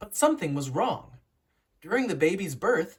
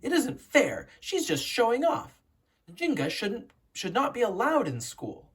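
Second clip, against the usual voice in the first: higher, sulky protest; scowling complaint; 7 semitones higher than usual. A narrator's reading. Jealous brother complains about his sister, higher, sulky. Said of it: "Mbandi scowled".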